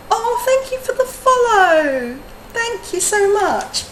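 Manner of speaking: very high, long drawn-out thank-you; gushing thanks. Real, not acted. Streamer thanking a new follower, gushing thanks. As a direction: stretch the words out high and slow, gushing.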